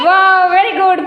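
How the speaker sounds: high, loud, slow delighted wow; excited praise. Real, not acted. Teacher praising a child's meow, loud drawn-out praise. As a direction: gush over the cat sound.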